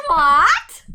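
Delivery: loud, high, stunned "What?"; shocked what. Real, not acted. Reacting to her cohost's joke, loud and shocked. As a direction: yelp it in disbelief.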